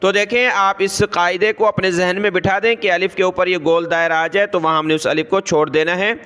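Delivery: ordinary delivery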